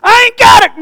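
high, loud preaching cry; overjoyed preacher's holler; exultant shout. Real, not acted. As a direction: shout it out high with full force. Preacher exults over good news.

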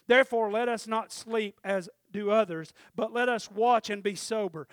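loud, measured Bible reading; scripture reading. Acted. Preacher reading the verse to his congregation, loud and steady. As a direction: read the verses out to the church.

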